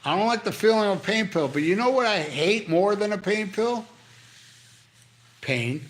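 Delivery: normal talking voice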